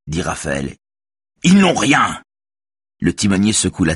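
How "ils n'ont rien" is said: high, raised insistent cry; angry outburst. A narrator's reading. Raphaël insists they have nothing, angry cry. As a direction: burst out high and indignant.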